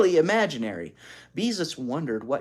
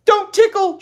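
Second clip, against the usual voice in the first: put-on high, loud shrieking child; 13 dB louder than usual. A narrator's reading. Ramona shrieks at her sister, high and loud. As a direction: shriek it while running away. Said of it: "shrieked Ramona".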